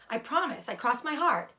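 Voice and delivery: high, earnest eager promise; eager pig voice. Acted. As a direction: swear it eagerly. Wilbur swears.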